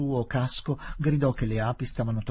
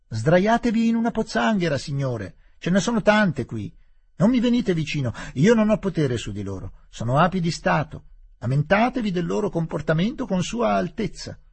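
raised, teasing mock advice; 8 semitones higher, 4 dB louder than usual. Aziz teasing the stung Englishmen, raised mocking voice. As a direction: gloat playfully from a safe distance.

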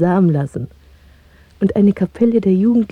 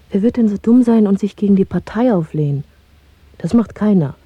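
ordinary talk

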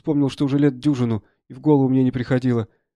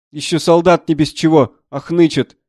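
raised, louder reproach; scolding doctor; 3 semitones higher, 7 dB louder than usual. Acted. Doctor to a soldier complaining of pain, scolding loudly. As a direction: shame the soldier for whining.

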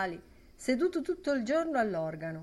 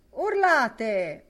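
loud, higher shout; boisterous call over the room; 5 semitones higher, 9 dB louder than usual. A narrator's reading. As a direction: bellow it across the bar. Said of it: "urlava Ben Dollard".

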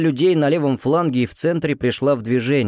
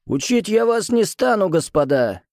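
put-on higher, rough old musketeer's bark; hoarse powerful voice; 9 semitones higher than usual. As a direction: boom it hoarsely over the ranks. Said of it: "Хриплый, но мощный голос мушкетёра разнёсся над нашими рядами".

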